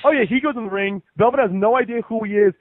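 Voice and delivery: ordinary talk, nothing marked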